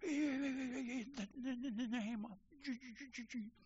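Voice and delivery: slow, soft, trembling struggle to get the words out; barely able to speak for fear; shaking, terrified prayer. Retold or imitated. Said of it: "he laid there shaking, going".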